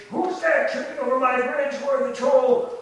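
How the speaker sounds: loud, high-pitched menacing troll bellow. Acted. Troll challenging the goat crossing his bridge, loud menacing bellow.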